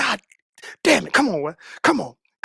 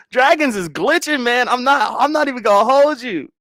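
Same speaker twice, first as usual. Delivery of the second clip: ordinary talk, nothing marked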